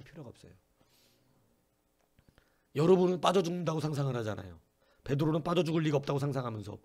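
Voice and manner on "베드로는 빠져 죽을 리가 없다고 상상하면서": usual speaking voice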